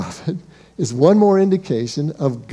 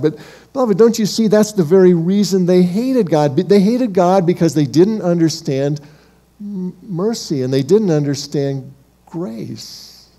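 ordinary talk

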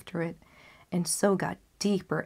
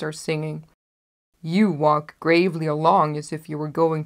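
louder, lightly voiced coaxing wolf; 2 semitones lower, 5 dB louder than usual. Wolf luring the girl toward the flowers, coaxing. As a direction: tempt her off the path, louder. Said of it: "and then he said".

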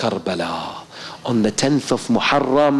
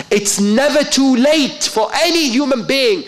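soaring, loud preacher's cry; preaching at full force; 11 semitones higher, 4 dB louder than usual. Acted. Preacher urging the congregation, loud and soaring. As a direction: ring it out over the congregation.

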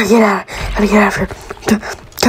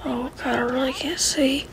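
whispering while hiding; 3 semitones higher, 10 dB quieter than usual. Real, not acted. Hiding from monsters in game.

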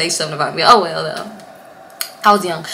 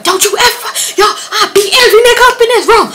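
high, loud mock-furious yell; sister turned Hulk; 13 semitones higher, 5 dB louder, faster than usual. Sister threatens the room after the shove, high mock-furious yelling. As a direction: act out an enraged protective sister. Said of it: "She turned into the hawk".

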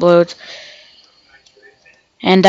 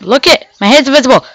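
higher-pitched, quick outburst; excited exclamation; 5 semitones higher, faster than usual. Real, not acted. Gamer showing viewers the invisible head, excited. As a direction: blurt it out, pitched up.

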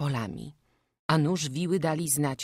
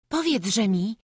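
usual speaking voice

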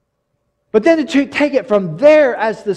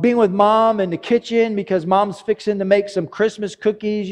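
usual speaking voice

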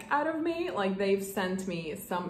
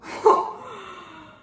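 loud, high gasp of surprise; startled gasp; 10 semitones higher, 6 dB louder than usual. Real, not acted. Unboxer seeing the gift inside, startled gasp. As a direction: gasp in real shock.

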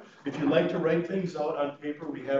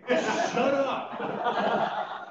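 amused echo; 10 semitones higher than usual. Real, not acted. Chaplain laughingly echoing the mother's retort. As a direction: repeat the punchline, chuckling. Speaker echoes the punchline, laughing.

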